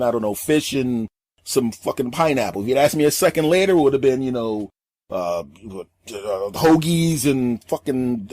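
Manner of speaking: ordinary talk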